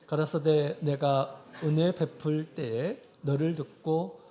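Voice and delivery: slow, forceful congregational reading; scripture reading aloud. Real, not acted. Preacher reading Corinthians with the congregation, slow and forceful scripture reading. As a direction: recite the verse firmly in evenly stretched phrases.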